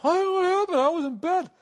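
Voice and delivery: very high, put-on innocent voice; hammed-up clueless act; playing innocent. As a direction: feign surprise in a squeaky high pitch. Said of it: "I'd just play it off".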